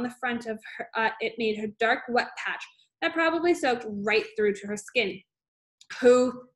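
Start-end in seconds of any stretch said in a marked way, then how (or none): 6.0-6.5 loud, slow bellow of fury; roaring. A narrator's reading. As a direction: roar it at the class like a furious headmistress. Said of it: "she roared".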